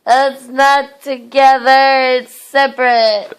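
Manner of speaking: loud whiny protest. Real, not acted. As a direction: shout it, refusing. Protesting being dragged to bed.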